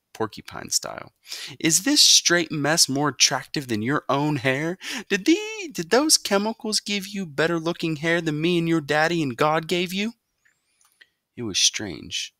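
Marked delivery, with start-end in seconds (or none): 1.6-10.1 higher, scornful questioning; mocking mother. A narrator's reading. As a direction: rub it in. Mother mocks hair.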